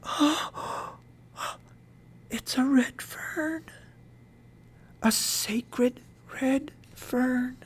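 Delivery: high, hushed, very slow awe; near whisper. A narrator's reading. Mother gasping at the sacred red fern, almost whispering. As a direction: breathe it out slowly, almost whispering. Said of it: "I heard her say almost in a whisper".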